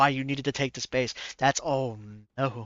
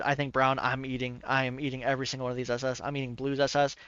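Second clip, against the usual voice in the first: ordinary delivery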